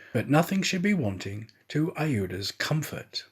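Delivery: ordinary delivery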